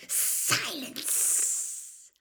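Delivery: high, loud, hissing exclamation; angry command. Acted. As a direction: silence the servant with a long hiss. Master cuts off spluttering servant.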